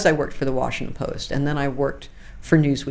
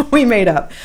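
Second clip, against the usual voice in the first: higher, louder, with a burst of laughter; amused, laughing repeat; 6 semitones higher, 7 dB louder than usual. Real, not acted. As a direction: say it through a real laugh. Laughs while telling the reconciliation.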